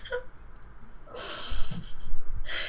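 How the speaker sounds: breathless, tearful laugh; a choked-up laugh fighting back tears. Real, not acted. Grieving sister breaks off emotionally.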